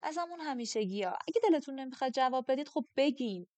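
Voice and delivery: piqued, impatient retort; a little miffed. A narrator's reading. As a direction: get testy and speed up as it builds. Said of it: "با کمی دلخوری گفتم".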